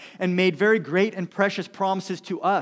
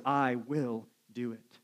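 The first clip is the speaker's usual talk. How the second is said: slow soft promise; 5 semitones lower, 7 dB quieter, slower than usual. Acted. God's promise, final emphatic form.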